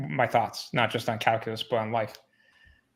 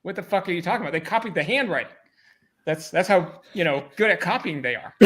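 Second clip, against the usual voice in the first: raised, heated pushback, higher and louder; exasperated retort; 6 semitones higher, 3 dB louder than usual. Retold or imitated. Retelling arguing with his boss, exasperated and raised. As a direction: tell the boss off, half-laughing in disbelief.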